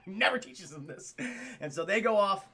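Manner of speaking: ordinary delivery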